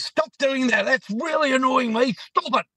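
high, indignant telling-off; imitated angry outburst. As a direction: act out an annoyed woman snapping at someone. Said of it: "turns around really angrily and says".

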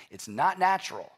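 ordinary talk, nothing marked

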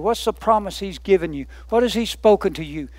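ordinary talk, nothing marked

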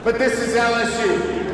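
ordinary delivery

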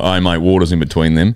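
a hint of a boozy old cricket member; old MCG member. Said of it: "A few octaves lower as well".

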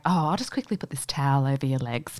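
imitating a nurse whispering; retelling a nurse who whispers over the patient; whispered nurse. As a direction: hush it like a nurse at the bedside. Nurse quoted quietly during procedure.

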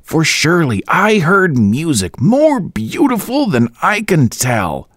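roaring. A narrator's reading. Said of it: "roared Boyce".

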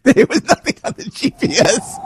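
high, laughing through the words; laughing while telling about the blank GPS screen. Real, not acted. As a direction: crack up as you say it.

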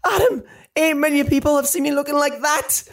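very high, horrified squawk without extra volume; mock scream. A narrator's reading. Mum to Adam about the video, screaming in dismay. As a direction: wail it high in acted dismay. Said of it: "she screamed".

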